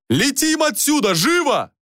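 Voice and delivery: high-pitched frantic order; yelling. A narrator's reading. Jorian on the rope orders takeoff, urgent high yell. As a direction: yell for an instant getaway. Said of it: "заорал он".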